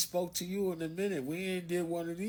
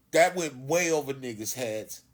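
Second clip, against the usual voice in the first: ordinary talk, nothing marked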